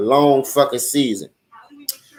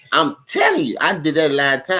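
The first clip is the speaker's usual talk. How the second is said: normal talking voice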